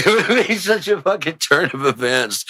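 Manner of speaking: high, laughing through the words; real amusement bubbling through. Real, not acted. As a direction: crack up at your own idea while talking. Cracks up at own bit.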